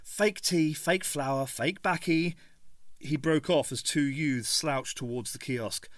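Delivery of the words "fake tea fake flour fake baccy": bitter Cockney. A narrator's reading. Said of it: "said the little man bitterly".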